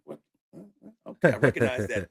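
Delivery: startled what, then a loud okay I recognize that; jolted, recognising a familiar accent; surprised recognition. Retold or imitated. Hearing a country accent and exclaiming in surprise. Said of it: "I was like".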